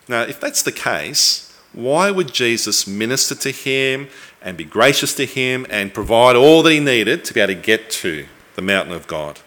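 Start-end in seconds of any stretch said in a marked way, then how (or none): none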